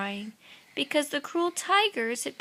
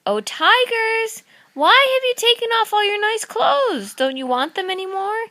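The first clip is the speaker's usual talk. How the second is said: calling out; 11 semitones higher, 7 dB louder than usual. Acted. Little Babaji calling to the far-off tigers, high loud voice. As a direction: call out across the distance in a high voice.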